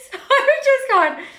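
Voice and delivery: high laughing lead-in. Real, not acted. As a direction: talk through a laugh. Laughing while retelling.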